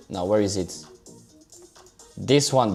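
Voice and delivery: ordinary talk, nothing marked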